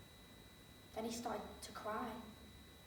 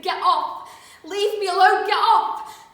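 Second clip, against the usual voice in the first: much higher, very loud cry to get free; desperate shout; 7 semitones higher, 20 dB louder, slower than usual. Acted. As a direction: scream at an attacker to let go. Acted struggle with an attacker.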